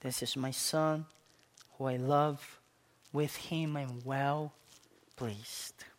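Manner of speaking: low, soft, fading to a whisper; hushed voice of the Father. Retold or imitated. Preacher voicing the Father's words to his congregation, hushed to a whisper. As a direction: speak the Father's words tenderly, almost breathless. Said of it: "My voice".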